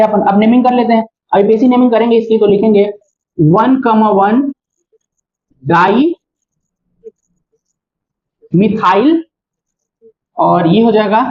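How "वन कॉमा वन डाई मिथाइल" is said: drawn out, with long pauses between parts; slow dictation. Real, not acted. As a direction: spell out the name piece by piece. Teacher dictating a compound name. (धीमे, रुक-रुक कर लिखवाते हुए)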